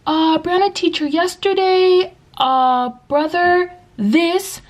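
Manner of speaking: put-on high, halting kid voice; slow, high, word-by-word pupil voice; imitating young student. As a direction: mimic a child hunting for English words. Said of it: "And then they'd be like".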